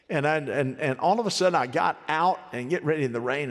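ordinary delivery

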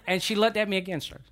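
ordinary talk, nothing marked

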